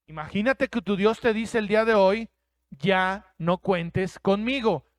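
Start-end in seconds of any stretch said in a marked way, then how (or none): 2.7-4.8 drawn out, slow and heavy; God telling you coldly, slowly; slow solemn warning. Pretend. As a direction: let each word land as God's final word. God's words, slowed for weight.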